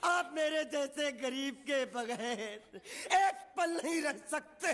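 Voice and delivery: hammed-up, drawn-out sobbing plea; wailing lament. Servant begging the master not to part from him, wailing. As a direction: draw out the words in theatrical wails.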